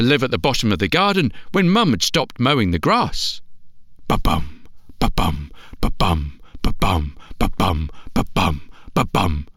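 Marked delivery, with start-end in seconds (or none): 4.1-9.5 low steady ba-bum like a heartbeat; deep drum-like ba-bum; heartbeat sound. Acted. Heartbeat sound imitated in story.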